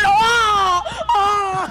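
alarmed cry. Real, not acted. Teammate about to die.

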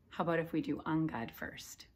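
ordinary delivery

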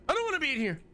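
scared in the daycare, high whiny plea; high whine. Real, not acted. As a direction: whimper it up high.

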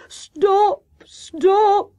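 high, loud, drawn-out wail; hammed-up moan of suffering. A narrator's reading. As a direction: wail it in theatrical agony. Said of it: "moaned Hare".

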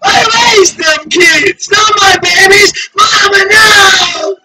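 high, drawn-out wailing shouts; screaming in anguish. Acted. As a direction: wail like a parent losing the children. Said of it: "hollers, yells, screams, shouts".

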